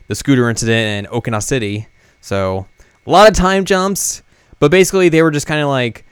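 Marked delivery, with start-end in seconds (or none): none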